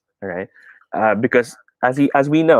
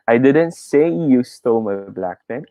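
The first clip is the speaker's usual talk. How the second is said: slow line with "say" stretched and lifted; stress on SAY; 3 semitones higher, slower than usual. Shown as a demonstration. Teacher contrasting saying with typing, stress on "say". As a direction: make "say" the key word. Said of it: "put it on the third word".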